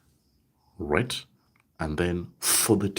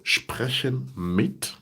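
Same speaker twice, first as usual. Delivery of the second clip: slow demo phrase; 5 semitones higher, much slower than usual. Shown as a demonstration. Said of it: "it is plus dative".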